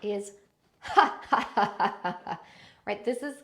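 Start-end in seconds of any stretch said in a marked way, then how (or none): 0.8-2.2 deliberate ha ha ha from the belly; staccato mock laughter; fake belly laughs. Shown as a demonstration. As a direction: push out fake laughs from the core. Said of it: "do a few fake laughs".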